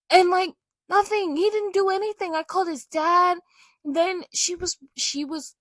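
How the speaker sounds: exasperated venting. Real, not acted. Venting over being teased.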